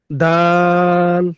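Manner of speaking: দাল drawn out very slowly, tongue tip on the upper teeth roots; demonstrating Arabic dal to students; Arabic letter dal. Shown as a demonstration. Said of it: "জিহ্বার আগাটা সামনের উপরে দুই দাঁতের গোড়ার সাথে লাগবে".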